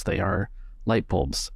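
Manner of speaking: ordinary delivery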